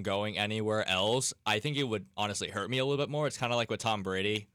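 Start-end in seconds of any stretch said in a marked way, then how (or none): none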